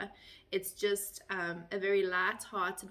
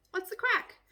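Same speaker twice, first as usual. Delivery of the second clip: Irish greeting; 12 semitones higher, 4 dB louder than usual. Shown as a demonstration. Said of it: "If an Irish person comes up to you and says".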